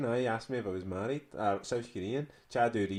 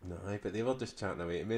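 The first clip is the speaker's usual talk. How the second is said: usual speaking voice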